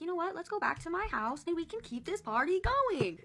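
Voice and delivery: high-pitched mock enthusiasm; playful, hyped-up party pitch; imitated eager invitation. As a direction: imitate him coaxing, voice climbing high. Imitates the man pushing the afterparty.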